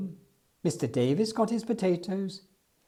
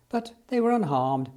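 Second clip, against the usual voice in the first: ordinary delivery, nothing marked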